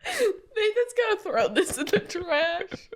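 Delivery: crying with laughter; laughing hard. Real, not acted. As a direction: talk through helpless laughter. She is laughing so hard she cries.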